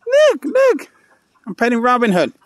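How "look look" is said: very high look look; excited squeal. Real, not acted. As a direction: squeak with delight.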